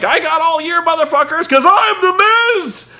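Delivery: high, loud hammed-up bragging, stretching the end; imitated manic boast. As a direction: crow it way up high, mock triumph. Miz boasting backstage, hammed up.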